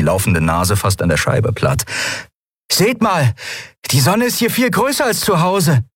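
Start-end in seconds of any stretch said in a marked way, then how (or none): 2.7-5.8 put-on much higher child voice; lightly voiced awestruck boy; Miko, amazed child. A narrator's reading. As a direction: point it out in wonder.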